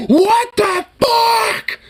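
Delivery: high, very slow, drawn-out shout; imitating emphatic yell. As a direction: stretch every word like an outraged friend. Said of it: "Dude, that emphasis".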